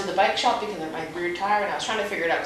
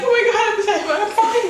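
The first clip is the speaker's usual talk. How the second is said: laughing reassurance; 13 semitones higher, 5 dB louder than usual. Retold or imitated. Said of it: "I'm like".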